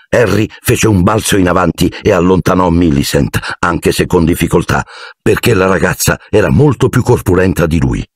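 ordinary delivery

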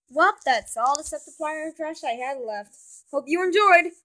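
ordinary talk, nothing marked

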